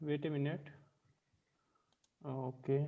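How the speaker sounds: barely audible, far from the mic; weak voice, distant from the microphone; faint voice. Real, not acted. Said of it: "very faint voice".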